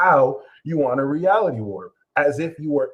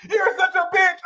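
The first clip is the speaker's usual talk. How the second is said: high mock shout; 3 dB louder than usual. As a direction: snap it high and loud. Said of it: "Sag snaps".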